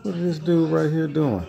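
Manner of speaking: squaring up to the dog for fun; mock tough talk to dog. Man confronting his dog playfully.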